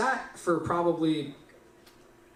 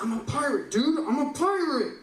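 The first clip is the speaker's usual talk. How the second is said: high-pitched exasperation, climbing sharply at the end; acted frustration boiling over; mounting frustration; 9 semitones higher than usual. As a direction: insist desperately, pitch leaping on the last repeat. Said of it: "like, more and more frustrated".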